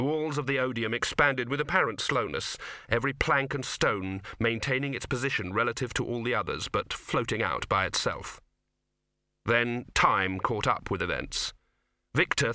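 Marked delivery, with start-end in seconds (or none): none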